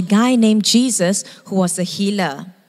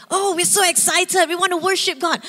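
excited; 7 semitones higher than usual. Retold or imitated. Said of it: "we shout for good reasons".

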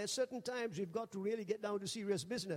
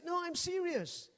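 higher and slower, mock earnest insistence; a retold protest, lightly acted; insisting; 9 semitones higher than usual. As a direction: press the point, half amused. Insisting to doubting pastor friend.

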